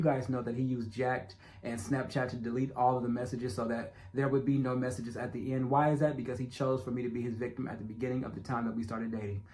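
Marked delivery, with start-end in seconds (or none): none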